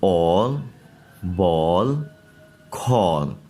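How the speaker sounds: ऑल, बॉल, कॉल with a round aw; model pronunciation. Shown as a demonstration. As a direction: read each word out clearly. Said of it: "हम कैसे पढ़ेंगे?". (गोल ऑ की आवाज़)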